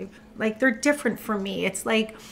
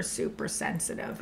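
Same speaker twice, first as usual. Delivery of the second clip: whispered trail-off; 12 dB quieter than usual. Real, not acted.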